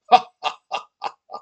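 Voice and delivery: loud, high staccato ha-ha laugh; acted breathy burst of laughter; big Viking laugh. Viking laughing as Olivia stomps, loud laugh.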